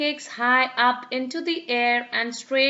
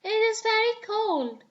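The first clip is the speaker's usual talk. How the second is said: disappointed; 10 semitones higher than usual. Acted. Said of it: "sighed Peppa".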